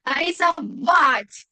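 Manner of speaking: slow рисовать, final A long and stressed. Shown as a demonstration. Teacher introducing the verb to draw, stressed final A in рисовать. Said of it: "tilde en la A".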